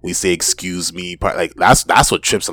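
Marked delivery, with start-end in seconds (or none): 0.3-1.2 softly enunciated "Excuse me"; polite, fully articulated "Excuse me"; fully pronounced words. Shown as a demonstration. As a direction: say "Excuse me" low and soft, every sound pronounced. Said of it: "We pronounce all of our words".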